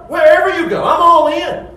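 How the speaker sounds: high, loud, eager shout of commitment; hasty eager vow. Retold or imitated. Scribe eagerly vowing to follow Jesus, loud excited shout. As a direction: blurt it out all in. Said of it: "This guy's hasty".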